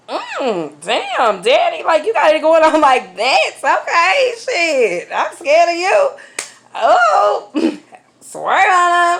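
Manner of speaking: high teasing flirt with laughs; mock flirty swoon. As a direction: coo at him in mock awe. Mock flirting over Kevin's page.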